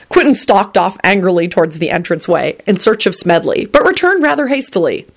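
plain reading voice

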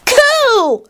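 very high awed squeal; high-pitched wondering cry; Teddy, shrieking. A narrator's reading. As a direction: shriek with a child's delight. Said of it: "Teddy shrieked".

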